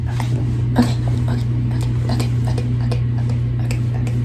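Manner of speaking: excited hushed whisper; frantic whisper. Real, not acted. Still whispering, parents asleep.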